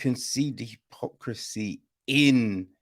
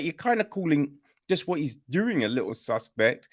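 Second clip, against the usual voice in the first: usual speaking voice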